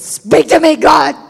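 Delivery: high, very loud mock-desperate yell; demanding shout. Preacher re-enacting her tantrum at God, demanding shout. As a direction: shout it at heaven in a hammed-up tantrum.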